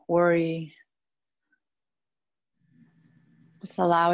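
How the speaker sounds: calm, even yoga nidra voice with long pauses; steady, low-key meditation guide, phrase by phrase; guided meditation. Acted. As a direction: lead them inward, unhurried, letting each phrase rest.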